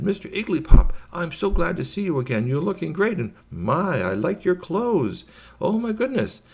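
high, gushing greeting; high-pitched, overjoyed guest character; effusive visitor. Acted. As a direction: gush with delight at seeing a friend.

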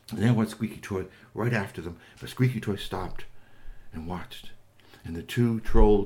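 ordinary delivery

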